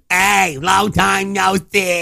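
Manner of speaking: high, slow, stretched greeting; impression of dad. Cohost mimicking friend's dad, high drawn-out greeting. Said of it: "That's exactly what he says".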